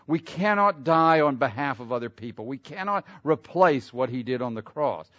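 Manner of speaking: loud, high fervent preaching; preaching at full force. Acted. Preacher stirring the church at full force. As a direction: lift the congregation with rising fervor.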